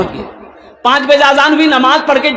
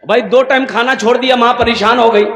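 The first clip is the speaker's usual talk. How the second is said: normal talking voice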